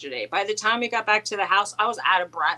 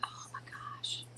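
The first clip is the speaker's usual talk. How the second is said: breathless whispered dismay; a stunned whisper; 19 dB quieter, slower than usual. Real, not acted. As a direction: breathe it out in a hush of disbelief. Reacting to shipping mishap.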